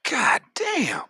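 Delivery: high, stunned exclamation; amazed god damn. Retold or imitated. Watching a streamer rake in donations, high amazed exclamation. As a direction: blurt it out in shock, way up high. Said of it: "I'm like".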